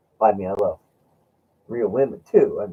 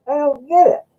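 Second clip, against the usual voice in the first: high puzzled friend; 7 dB louder than usual. Retold or imitated. Friend puzzled by beater coin.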